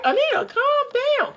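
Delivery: high, laughing mock of dad's soothing; dad calming her. Retelling her dad telling young Anita to calm down, in a high laughing imitation. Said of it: "My dad was like".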